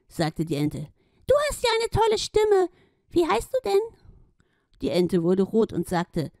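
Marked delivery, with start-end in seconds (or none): 1.3-3.8 very high, gushing glowing hippo voice; a tiny shining hippo overjoyed; delighted Gloria. Acted. Gloria welcomes Schlomi and his friend, very high and delighted.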